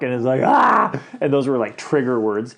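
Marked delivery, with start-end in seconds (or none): 0.4-1.0 high, loud pained "ah"; mock dread groan. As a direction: let out a hammed-up groan. Reacting to trigger words.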